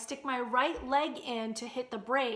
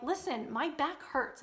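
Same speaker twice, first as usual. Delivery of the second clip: ordinary talk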